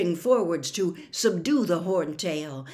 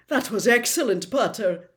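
crying out praise; 3 semitones higher, 5 dB louder than usual. A narrator's reading. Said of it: "cried Mi-, Professor McGonagall".

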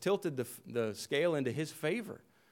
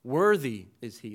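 "Worthy" punched louder than the rest; emphasis on Worthy; 6 dB louder than usual. Shown as a demonstration. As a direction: lean hard on the first word, "Worthy". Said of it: "they emphasize it by putting that word first".

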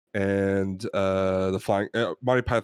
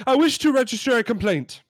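high-pitched British customer lodging a complaint; indignant British pet shop customer; British accent; 11 semitones higher, 3 dB louder than usual. Acted. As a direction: put on a loud-voiced, high British accent. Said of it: "We're gonna try our British accents".

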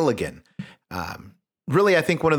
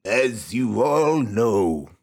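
lightly voiced king; 3 semitones lower, much slower than usual. King addresses the great hall.

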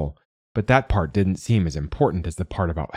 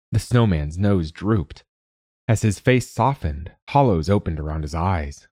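ordinary delivery

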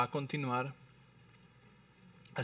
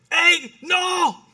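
high, loud yell to stop someone; sharp warning shout; 13 semitones higher, 10 dB louder than usual. Acted. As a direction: shout it to stop them in their tracks. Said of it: "le levanto la voz a alguien".

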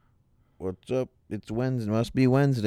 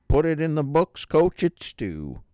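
catchphrase voice; 10 semitones higher, 7 dB louder than usual. Acted. Catchphrase in character.

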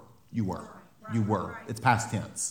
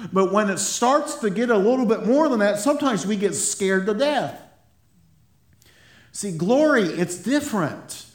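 raised, higher preaching voice, pressing each point; 7 semitones higher than usual. Acted. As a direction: preach it out to the congregation with fervour. Preaches with rising fervour.

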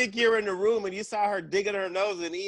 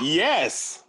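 loud, proud yes; a proudly emphatic admission; real proud; 5 semitones lower, 4 dB louder than usual. Real, not acted. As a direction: say it with emphatic, unashamed pride. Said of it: "I said that real proud".

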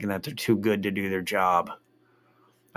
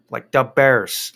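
slow, loud Chicago Da Bears; Chicago accent; 3 semitones higher, 7 dB louder, slower than usual. Retold or imitated. Mocking Chicago fans in the stands, slow loud Chicago accent. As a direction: drawl it like a Chicago superfan. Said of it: "like, with the accent".

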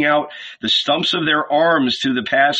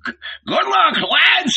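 very high, stuttered send-off; high-pitched innkeeper yell; crying out; 3 dB louder than usual. A narrator's reading. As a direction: shout a farewell in a sky-high voice. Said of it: "he cried".

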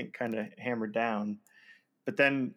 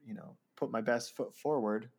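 ordinary talk

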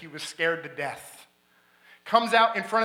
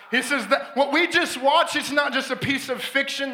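high, loud revival preaching; preaching at full force; 7 semitones higher, 4 dB louder than usual. Retold or imitated. Preacher sharing how the friend called the crowd, loud and high. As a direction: call the whole theater to come forward.

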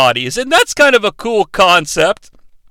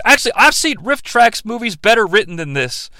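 ordinary talk, nothing marked

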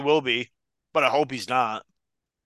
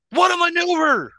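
high, loud hammed-up play-by-play cry; over-the-top announcer exclamation; mock commentator; 5 dB louder than usual. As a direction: shout it like a ringside announcer.

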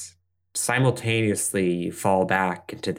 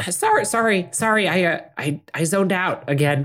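put-on high flustered young voice; high startled apology; 10 semitones higher than usual.

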